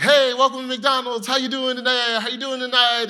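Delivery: high, loud bubbly greeting; cheery drive-thru guy. Retold or imitated. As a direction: greet every car like the happiest worker alive. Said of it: "and be like".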